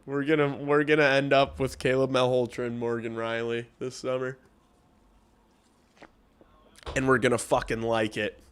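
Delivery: 목소리를 높인 열띤 주장; loud rant. Real, not acted. Ranting about trades to chat.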